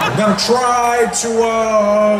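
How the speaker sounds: halting presidential speech with stretched fillers; Barack Obama, buffering. Acted. President promising better internet, halting drawn-out voice. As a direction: stall between phrases like a buffering video. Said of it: "his voice sounded like it was buffering".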